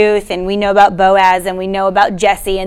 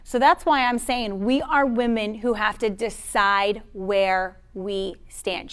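normal talking voice

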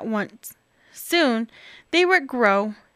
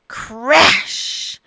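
plain reading voice

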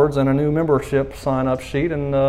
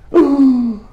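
gasp; 9 semitones higher, 4 dB louder than usual. Pretend. Punchline after a gasp.

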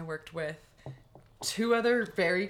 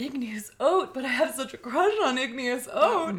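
excited crush; 10 semitones higher, 6 dB louder than usual. Retold or imitated. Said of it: "And I'm like".

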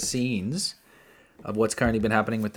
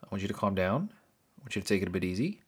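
lower, softer mock-parental lecture; stern sit-down talk; 3 semitones lower, 6 dB quieter, faster than usual. Fan lectures [name] about money, soft mock-serious. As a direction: calmly scold a spendthrift friend. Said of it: "I'd have to sit him down and go".

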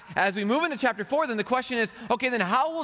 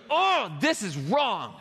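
slow, drawn-out groan of protest; mock dismay, stretched slowly; exasperated "oh"; slower than usual. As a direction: sigh it out like something's unfair. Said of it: "like, when you go".

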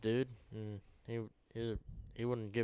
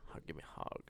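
whispered, lower pitch; 6 semitones lower, 8 dB quieter than usual. Pretend. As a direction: breathe it softly. Whispered hug request.

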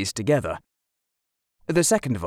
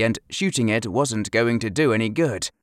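ordinary delivery

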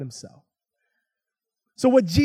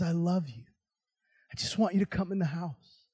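soft, gentle declaration of love; hushed, lowered fatherly warmth; tender father; 3 semitones lower, 9 dB quieter than usual. Acted. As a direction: plead quietly with a beloved son. Father lowers himself to plead lovingly.

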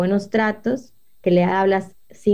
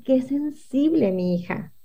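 slow, softer sneering sigh; mock dismissive; 4 semitones higher, 4 dB quieter, slower than usual. Mother shrugs off daughter's complaint, mock dismissive. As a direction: sigh it off as overreaction. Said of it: "yo ahí podría decir".

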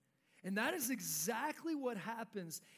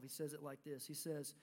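normal talking voice

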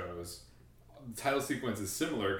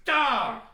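frustrated cry; 8 dB louder than usual. Retold or imitated. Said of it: "I was just like".

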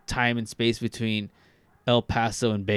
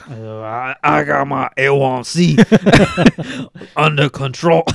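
put-on hammy Cajun accent, louder, laughing through it; 6 dB louder than usual. Cajun accent, bragging about his A1C to his cohost. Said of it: "you do a fantastic Cajun accent".

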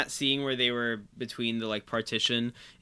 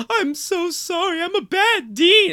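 much higher, slow, whimpering dean; the dean sobbing out an apology, much higher and slow; mock crying dean; 7 dB louder, slower than usual. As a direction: play the dean falling apart in tears, hammed up. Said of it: "when the dean starts crying".

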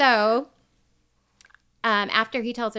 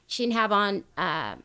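ordinary talk, nothing marked

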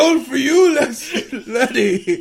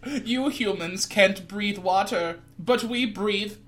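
mock alien voice; slower than usual. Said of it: "I am Glick Glock".

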